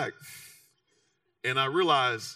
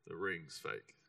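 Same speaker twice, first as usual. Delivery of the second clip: the verdict repeated lower and much softer, almost to himself; hushed echo; 12 semitones lower, 17 dB quieter, slower than usual. Retold or imitated. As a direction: let it sink in, barely voiced.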